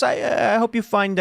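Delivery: a menacing mob guy wishing you well; Sopranos mobster. Retold or imitated. Host voices a Sopranos mobster's veiled threat, hammed-up mob voice. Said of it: "if a soprano guy says".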